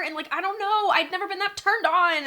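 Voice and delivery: high, loud rush of infatuation; worked-up gushing. Acted. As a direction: gush it, pitch soaring. Gushing about a lover's seduction.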